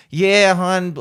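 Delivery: Baltimore "Yeah, hon"; Baltimore accent. Retold or imitated. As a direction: drawl it like a Baltimore local. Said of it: "hear people like".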